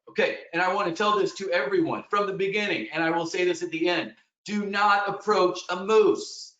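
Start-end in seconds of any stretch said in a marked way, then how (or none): 4.4-6.5 a slow, emphatic warning leaning on not; stress on not. Real, not acted. Guide warning hikers, slow stress on not. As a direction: Do not approach a moose, not hit hard and slow. Warning everyone about moose.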